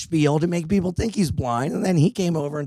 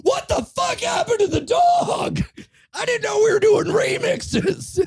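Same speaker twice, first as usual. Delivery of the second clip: much higher, slow shouted disbelief; mock outraged shout; slower than usual. Rider shouting back at the subway singer, high and slow. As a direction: yell it in comic disbelief. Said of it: "And I was like".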